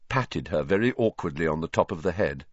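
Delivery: plain reading voice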